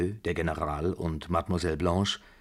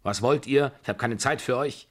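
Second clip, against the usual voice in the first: higher, impatient snap; brusque, raised-voice dismissal; shouting; 6 semitones higher, 4 dB louder than usual. A narrator's reading. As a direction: shout them out of the way. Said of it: "schrie die Babuschka".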